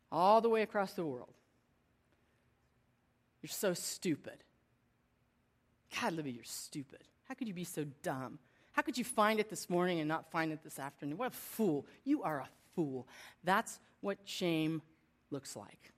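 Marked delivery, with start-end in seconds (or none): 3.4-13.1 self-berating inner voice. Retold or imitated. Voicing her shaming inner voice.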